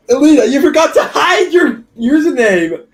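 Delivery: high, loud, laughing call-out; laughing shout. Real, not acted. Reader teasing Elita over her username, laughing shout. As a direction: tease Elita through real laughter.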